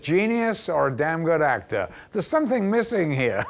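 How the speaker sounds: high, comic protest; mock indignant. Actor objects to director's unequal praise, mock indignant. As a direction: weigh the two labels in a rising, amused complaint.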